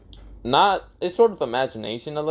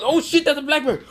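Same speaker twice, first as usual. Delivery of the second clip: high-pitched frightened cry; hammed-up terror, voice shooting up; mock panic; 14 semitones higher, 4 dB louder than usual. Two girls fleeing the roaring bear, high mock panic.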